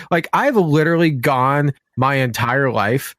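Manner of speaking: ordinary delivery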